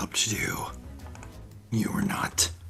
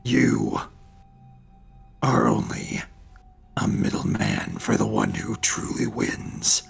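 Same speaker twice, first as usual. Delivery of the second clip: defiant; 3 dB louder than usual. Acted. Grom defies the devil.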